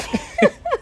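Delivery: sudden high giggling laugh; bursting into laughter at a joke. Real, not acted. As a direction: let a real laugh break out high. Laughing at her own joke.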